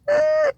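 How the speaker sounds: very high, loud strangled yelp; mock choking gasp. Fake octopus attack on sister, high strangled yelp. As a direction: yelp as if pulled underwater. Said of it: "So I'd be like".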